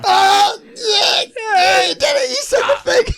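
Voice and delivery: high, loud whooping cheer; excited cheering shout. Real, not acted. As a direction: cheer like he scored. Cheering a milestone.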